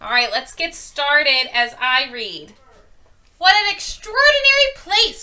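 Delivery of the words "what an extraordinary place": higher and louder, full of wonder; excited cry. A narrator's reading. Lucy marvelling at the stone courtyard, excited cry. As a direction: gasp it out as if seeing a marvel. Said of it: "cried Lucy".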